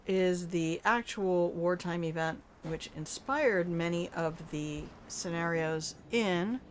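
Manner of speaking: usual speaking voice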